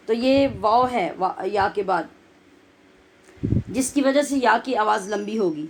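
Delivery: ordinary talk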